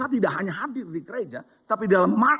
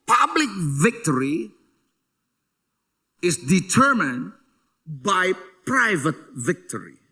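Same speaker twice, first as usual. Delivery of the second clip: "Public victory is determined by private victory" spoken very slowly; drawn-out weighty quotation; slow solemn maxim; 2 semitones lower, much slower than usual. Real, not acted. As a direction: let each word land like a proverb. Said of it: "Ada sebuah statement yang menarik".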